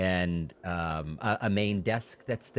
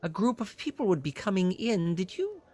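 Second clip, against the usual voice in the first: high, eager innkeeper voice; fussy innkeeper; 11 semitones higher than usual. Acted. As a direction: play a flustered, helpful innkeeper pitched high. Innkeeper asks about Sildar.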